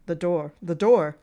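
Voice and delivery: very loud, urgent cry; panicked shout. Acted. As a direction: bellow it twice.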